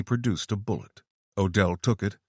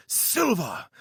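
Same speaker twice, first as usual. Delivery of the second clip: fake British; 10 semitones higher than usual. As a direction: land "silver!" with a knowing flourish. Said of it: "The British accent returned".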